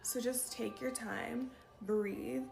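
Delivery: slow, unhurried coaching; slow advice. Shown as a demonstration. Coaching viewers to slow down, said slowly. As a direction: model the slowness as you say it. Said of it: "advice to speak slower".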